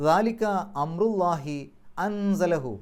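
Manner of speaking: slow, chanted Quranic recitation. Shown as a demonstration. Teacher recites the verse, slow chanted recitation. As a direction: intone the verse slowly. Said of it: "ഇഖ്ഫാ ചെയ്യണം".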